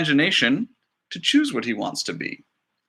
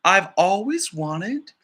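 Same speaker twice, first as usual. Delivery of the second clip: slow, slightly higher confession; lightly voiced giraffe; 5 semitones higher, slower than usual. Giraffe telling the director its wish, lightly voiced. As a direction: shyly admit the dream.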